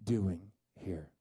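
God gently confronts the fleeing prophet; still small voice. Acted. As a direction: let God ask it slowly and quietly. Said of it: "And God says".

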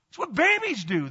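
normal talking voice

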